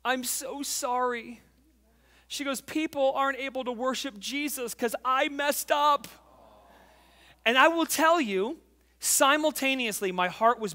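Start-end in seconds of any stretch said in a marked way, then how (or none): 0.1-1.4 hushed "Daddy", then a slow, higher apology; small, sorrowful child's voice; heartbroken daughter. Retold or imitated. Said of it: "her heart is broken". 2.6-6.1 lightly voiced upset child, pitched higher; a hint of a distressed kid; heartbroken daughter. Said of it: "her heart is broken".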